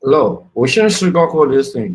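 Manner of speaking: loud and insistent, cutting in; forceful loud opening on a panel show. Real, not acted. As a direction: come in hot and loud, no sugarcoating. Heated radio panel argument.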